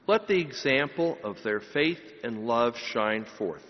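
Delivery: ordinary delivery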